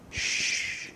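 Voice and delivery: soft, drawn-out shush; long quiet "shh"; hushing. Acted. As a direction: hush them gently but insistently. Voices that hinder faith, imitated hush.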